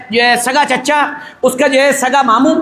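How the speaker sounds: ordinary talk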